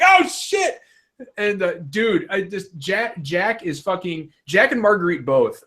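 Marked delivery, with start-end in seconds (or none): 0.0-0.8 high startled yelp of mock panic; mock fright. Gamer caught by the villain, high startled mock panic. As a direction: jump at the scare, half-joking. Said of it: "And I was like".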